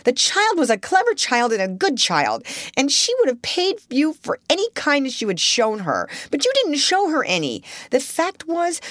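high, pouring it all out; the timid sister finally lets rip; reckless courage. Acted. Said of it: "with a kind of reckless courage".

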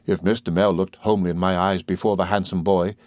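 ordinary delivery

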